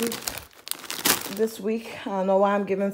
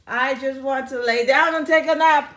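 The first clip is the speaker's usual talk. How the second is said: higher, louder wail of wanting rest; exclaimed weary plea; 4 semitones higher, 7 dB louder than usual. Real, not acted. Vlogger pleading for a nap mid-haul, loud complaint. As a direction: belt it out as a plea.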